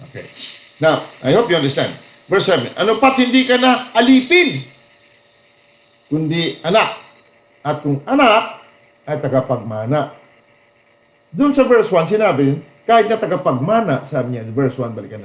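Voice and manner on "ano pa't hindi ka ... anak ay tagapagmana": raised, proclaiming Bible reading; scripture reading. Acted. Preacher reading Galatians to the congregation, proclaiming. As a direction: proclaim the verse to the church. Reads Bible verse aloud.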